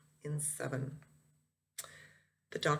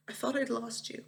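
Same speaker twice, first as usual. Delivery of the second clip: tearful; 6 semitones higher than usual. Acted. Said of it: "I kept bawling and bawling".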